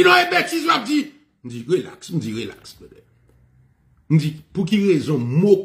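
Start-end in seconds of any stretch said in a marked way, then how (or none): 0.0-1.1 agitated retort. Retold or imitated. Student dismissing the speaker's words as nonsense, agitated. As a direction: burst out like a man who won't calm down. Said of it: "agité".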